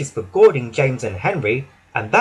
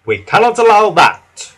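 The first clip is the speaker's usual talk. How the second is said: stern declaration; 7 semitones higher, 7 dB louder than usual. Acted. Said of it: "said the Fat Controller".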